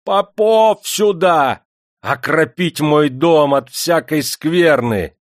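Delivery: high, loud, drawn-out holler; imperious, stretched-out shout; shouting through the house. A narrator's reading. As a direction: bellow it slowly for the whole household. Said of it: "закричал на все хоромы".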